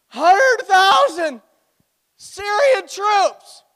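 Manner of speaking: very high, loud, stretched-out shout; theatrical panicked yell; hammed-up alarm. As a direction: shout the huge number in mock disbelief. Servant counts up the enemy, overwhelmed.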